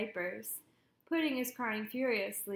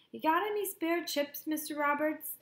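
called out higher and louder to the iceman; calling out; 5 semitones higher, 4 dB louder than usual. A narrator's reading. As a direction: hail the man across the street. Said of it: "Beans calls out".

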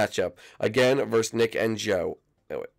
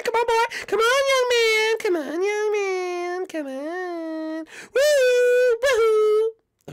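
a hammed-up falsetto cry of glee; shrieking taunt; 4 dB louder, much slower than usual. Taunting Dane in the game, a high-pitched squealing cry.

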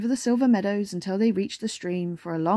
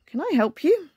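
lightly voiced frog, higher; croaking frog; 7 semitones higher than usual. A narrator's reading. Small frog offers bear help, lightly croaked. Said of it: "croaked a frog".

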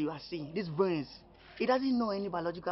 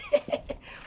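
laugh. Acted. Flirting, laughs at her.

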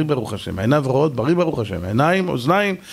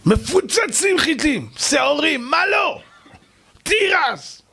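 excited shout; 10 semitones higher, slower than usual. Acted. Preacher to congregation, amazed at the ant's stores, shouting high. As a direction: cry out in amazement at the riches.

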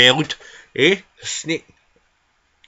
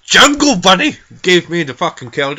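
louder, higher angry granny rant; pissed off; 5 semitones higher, 7 dB louder than usual. Acted. Angry granny ranting about who gave her the cold, pissed off. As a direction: burst out loudly with indignant blame. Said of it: "I'm fucking pissed off".

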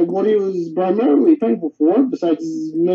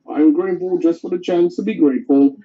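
ordinary delivery

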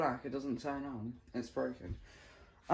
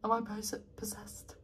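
soft, whispery repetition; hushed questioning; 9 dB quieter than usual. Pretend. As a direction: ask under the breath, over and over. Eerie repeated question to self.

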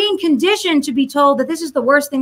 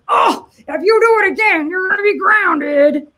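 higher, slow warning that doesn't bite; scolding dad again; 5 semitones higher, 3 dB louder than usual. Retold or imitated. Dad repeating his empty threat to the kid, higher and slow. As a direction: repeat the empty threat with the same high bark. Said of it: "and Dad just goes".

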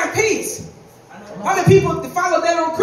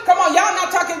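ordinary talk, nothing marked

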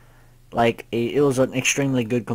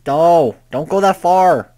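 higher, much louder warning cry; panicked yell; 5 semitones higher, 9 dB louder than usual. Real, not acted. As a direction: shout a warning at the screen. Yelling at the character.

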